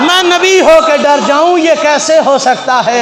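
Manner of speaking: the Prophet reassuring Aisha, shouted; preaching shout. Acted. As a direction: declare fearlessness at full force.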